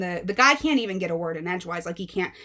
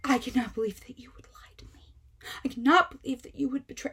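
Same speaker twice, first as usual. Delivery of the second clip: mom's teary disbelief; 4 semitones higher, 4 dB quieter, faster than usual. Retold or imitated. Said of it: "turns and, like".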